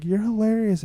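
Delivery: a milder fan compliment; toned-down fan. Retold or imitated. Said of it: "but she was like".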